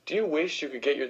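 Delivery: ordinary delivery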